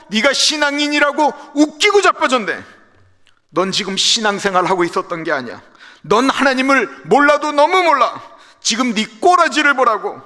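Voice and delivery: high, loud, sneering taunt; mocking angry friend. Friend sneering at Job, loud and mocking. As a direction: jeer at Job like a cruel friend. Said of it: "우리의 언어로 푼다고 한다면".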